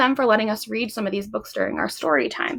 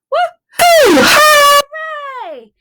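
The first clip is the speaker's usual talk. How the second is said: high, loud woo-hoo and hooray; big excited whoop; loud cheer; 11 dB louder, much slower than usual. Acted. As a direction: cheer like a party. Cheer in the song.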